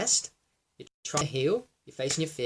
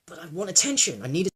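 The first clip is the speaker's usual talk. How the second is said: higher, insistent plea for attention; mock demand, louder and higher; demanding ego; 4 semitones higher, 5 dB louder than usual. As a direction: play the needy ego, pushing on "attention". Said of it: "the ego's like".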